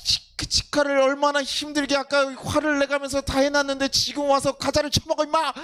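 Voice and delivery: mock angry yell; mock scolding. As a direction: scold the snacking kid, half-playful. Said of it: "이거 보고 제가 뭐랍니까?".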